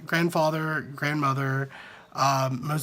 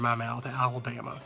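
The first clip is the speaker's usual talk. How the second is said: Alabama in a thick country accent; 3 semitones lower, 5 dB quieter than usual. Shown as a demonstration. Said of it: "sounds really country".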